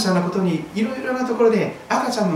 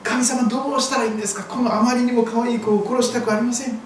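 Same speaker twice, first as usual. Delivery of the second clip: acted pleading, rising higher; earnest prayer; 4 semitones higher than usual. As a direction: plead with God as a desperate parent, building in pitch. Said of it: "真剣になって祈ったでしょう". (必死に神に祈る親を演じて)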